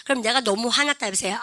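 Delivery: ordinary talk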